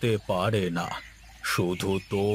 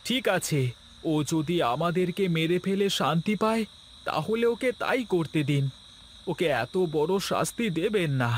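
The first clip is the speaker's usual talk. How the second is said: much higher, imploring voice; pleading for mercy; 7 semitones higher than usual. Acted. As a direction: beg the saint to relent. Gopal pleads selflessly. (উঁচু গলায় করুণ মিনতি)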